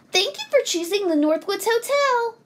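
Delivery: high, quick mouse receptionist; hotel mouse. A narrator's reading. Mice seeing off hotel guests, high and quick. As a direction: chirp a rote hotel farewell, high and fast. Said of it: "said the mice".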